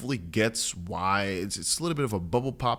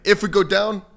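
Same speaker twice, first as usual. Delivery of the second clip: loud, high-pitched mock battle cry; rallying shout; 10 semitones higher, 10 dB louder than usual. Host rallying followers as all go down together, loud high shout. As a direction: belt it out to rally the troops.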